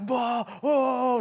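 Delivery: high, rowdy "whoa"; imitated party holler; mock party whooping. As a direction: whoop like a drunk reveler. Imitating rowdy late-night partying.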